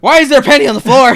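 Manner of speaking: very high, loud, fast; surprised laughing. Real, not acted. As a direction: blurt it out laughing. Spots a penny, laughing.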